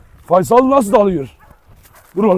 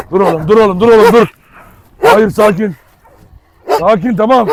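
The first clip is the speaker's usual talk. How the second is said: loud command; 3 semitones higher, 7 dB louder than usual. Real, not acted. Holding back a pulling dog.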